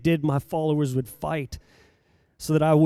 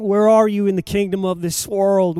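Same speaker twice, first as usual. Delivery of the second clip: normal talking voice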